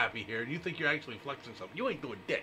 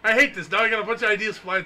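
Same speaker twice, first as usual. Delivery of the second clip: loud outburst; 8 semitones higher, 10 dB louder than usual. Real, not acted. Sudden outburst on air.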